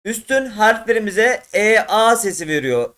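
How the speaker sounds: ordinary talk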